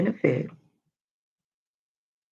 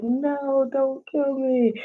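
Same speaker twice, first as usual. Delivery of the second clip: high pleading cry of a horror victim; mock terrified plea; 10 semitones higher, much slower than usual. Imitating a horror movie victim begging for her life. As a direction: beg for your life like the lady on screen.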